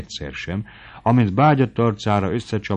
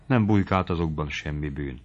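ordinary delivery, nothing marked

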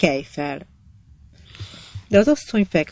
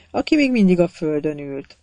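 ordinary delivery, nothing marked

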